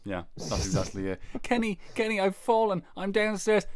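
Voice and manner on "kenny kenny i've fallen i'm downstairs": put-on high, frail old lady calling; a fallen grandma calling weakly. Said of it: "grandma voiced in skit".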